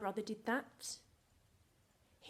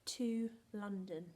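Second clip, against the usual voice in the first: slow, chunked words with pauses; deliberate model reading for children to echo; say-along dictation; much slower than usual. Shown as a demonstration. Teacher repeats the sentence with the class, slowly and clearly.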